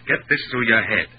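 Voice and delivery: ordinary delivery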